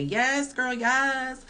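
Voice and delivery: put-on high, excited hype-girl cheer; cheering yes girl. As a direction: cheer her on like a fan. Viewers cheering her on.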